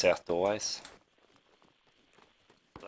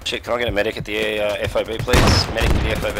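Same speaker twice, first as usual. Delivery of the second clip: loud, urgent plea for help; urgent call for medic; 3 semitones higher, 8 dB louder than usual. Real, not acted. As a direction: shout for a medic, hurt. Wounded, calling for medic.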